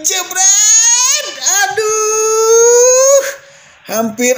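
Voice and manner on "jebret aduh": high, loud anguished cry; excited shout. Real, not acted. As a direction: cry out at the miss. Shot just misses.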